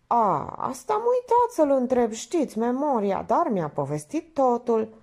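high, airy chatter; scatterbrained Minerva. Acted. Minerva excusing her forgetfulness, scatterbrained. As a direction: breeze past the forgotten detail.

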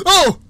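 high, loud shriek of surprise; sudden real fright scream; startled yelp. Real, not acted. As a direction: yelp as if jump-scared. Startled by the game.

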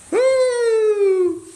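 high loud woo; whoop. Real, not acted. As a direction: celebrate with a big woo.

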